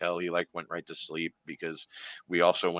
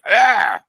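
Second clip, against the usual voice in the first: high, loud raven squawk; imitated raven caw; 15 dB louder than usual. Showing friends how the raven called, loud imitated caw. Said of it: "it caws at me".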